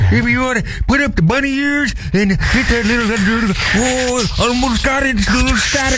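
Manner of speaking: put-on high, wavering old-timer fiddling with the radio; old man voice. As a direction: play a creaky old man. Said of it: "from your old man impression".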